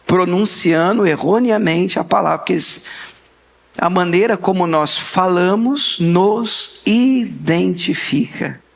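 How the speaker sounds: ordinary delivery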